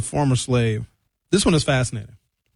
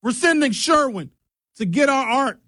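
family demanding it back; 9 semitones higher, faster than usual. Retold or imitated. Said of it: "the family can be like".

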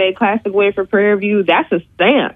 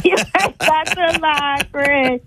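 laughing; 8 semitones higher, slower than usual. Real, not acted. Laughing banter, mock voice.

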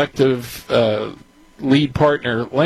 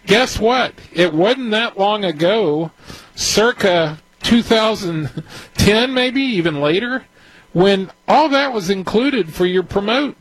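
higher-pitched, worked-up rant; agitated excitement; 6 semitones higher than usual. Real, not acted. Advisor challenging syndicators over their fees, agitated. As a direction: get worked up as the point builds. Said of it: "my voice is getting a little higher".

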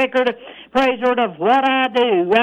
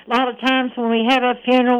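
ordinary delivery

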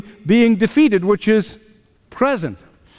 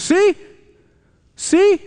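high, pointed "See?"; accusing Satan; 10 semitones higher, 3 dB louder than usual. Acted. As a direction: crow it like a prosecutor catching someone out. Voices Satan accusing in court.

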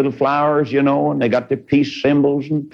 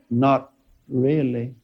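dead sound; 4 dB quieter, slower than usual. Retold or imitated. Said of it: "old dead sound".

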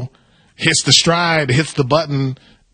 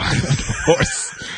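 laughing; 5 dB quieter, slower than usual. Real, not acted. Laughing at the under horse joke.

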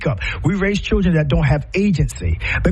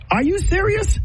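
disbelieving, pitch leaping much higher; outraged disbelief, voice shooting up; are you kidding me; incredulous; 14 semitones higher than usual. Real, not acted. Incredulous reaction to hush-up.

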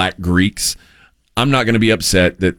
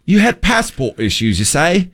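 high, slow put-on British accent; exaggerated British questioning, pitched up; mock British accent; 7 semitones higher than usual. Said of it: "Trying to copy a British".

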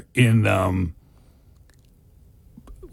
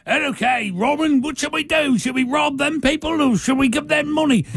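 much higher, silly put-on voice of eager followers; mock merry men; 10 semitones higher than usual. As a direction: ask Robin like clueless sidekicks.

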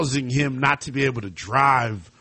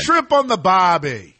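louder, hammed-up Australian catchphrase; mock Australian accent; 6 semitones higher, 6 dB louder than usual. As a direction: belt the cliché in a jokey Australian accent. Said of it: "That's an Australian accent".

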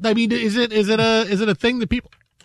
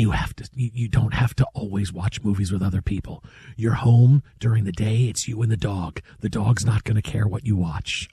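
low hushed whisper; 8 semitones lower, 5 dB quieter than usual. Pretend. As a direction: whisper the advice. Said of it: "what if we whisper".

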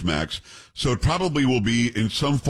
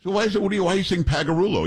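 puzzled challenge; 8 semitones higher, faster than usual. Retold or imitated. Retold exchange with his grandfather.

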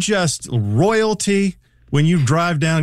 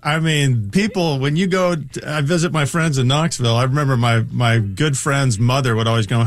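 ordinary talk

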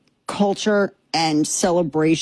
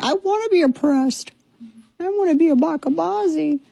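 much higher mocking put-on voice; 10 semitones higher than usual. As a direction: sneer at them in a silly high voice. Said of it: "And then you're like".

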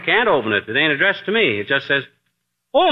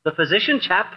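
usual speaking voice

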